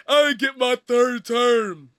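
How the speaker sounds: slow, puffed-up mock old-man voice; mock Trump voice. Mocking the old man's plan, a lightly voiced smug Trump. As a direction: drag it out like a smug old politician.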